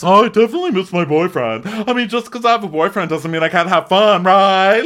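high, loud, quick put-on chirp; mock defensive girlfriend. Girlfriend excusing her fun to the others, mock breezy high voice. Mocking Julia's defensive boyfriend talk.

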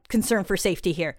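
ordinary delivery

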